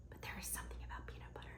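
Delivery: whispered like a secret; confiding whisper. Real, not acted. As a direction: let it slip out in a whisper, sharing a guilty pleasure. Confiding love of peanut butter.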